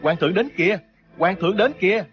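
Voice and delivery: high, excited crowd cry; acted onlooker's high cheer. A narrator's reading. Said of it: "Dân chúng liền reo lên".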